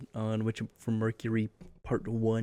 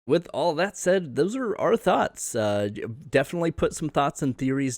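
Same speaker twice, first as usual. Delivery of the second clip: upbeat outro voice; 6 semitones higher, 4 dB louder than usual. Acted. Wrapping up the episode.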